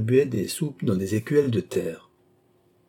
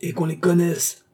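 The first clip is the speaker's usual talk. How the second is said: high-pitched narrated mob demand; relentless shouting; 8 semitones higher than usual. A narrator's reading. Crowd demanding the women be exposed, high shouting. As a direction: cry it out high and insistent. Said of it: "continuaient de crier les acharnés".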